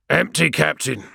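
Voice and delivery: gritty helmeted warrior reporting up; grating voice. A narrator's reading. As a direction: grate it out loudly from inside a helm. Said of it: "he said in a grating voice, made grittier by his helm".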